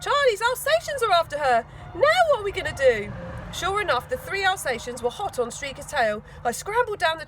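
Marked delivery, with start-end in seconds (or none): 0.0-3.1 high, loud alarmed cry; panicking about the dogs; dismayed cry. Acted. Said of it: "dismayed cry".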